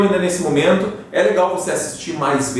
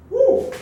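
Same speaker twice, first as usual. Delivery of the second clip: excited whoop. Real, not acted. Teacher's excited interjection.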